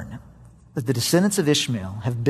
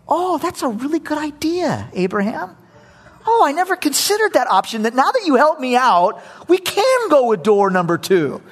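mock agreement; 9 semitones higher than usual. God mockingly pretending to agree with Abraham. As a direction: overplay a fake yes, pitched high. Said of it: "God doesn't say".

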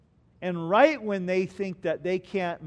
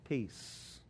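breathy whisper of the word peace, falling; whispered answer; 6 semitones lower, 11 dB quieter than usual. Acted. Pastor to his church, answering his own question in a whisper. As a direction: hush it like a secret.

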